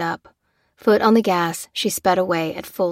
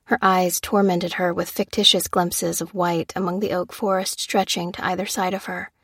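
plain reading voice

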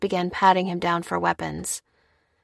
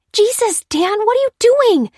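very high, loud, frantic protest; high-pitched, exasperated alarm; appalled outburst; 8 dB louder than usual. A narrator's reading. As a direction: demand an answer in horrified disbelief. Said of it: "hissed Lucy".

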